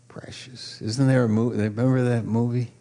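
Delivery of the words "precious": precious breathed in a whisper. Shown as a demonstration. Pastor whispering the word to his congregation. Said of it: "I love that word".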